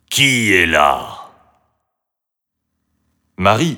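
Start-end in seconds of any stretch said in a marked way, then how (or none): 0.1-1.3 slow, weighty dragon voice; dragon's gentle question. A narrator's reading. Unseen dragon asks who is at the door, slow and sonorous. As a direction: ask slowly, with full voice. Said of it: "une voix demanda doucement".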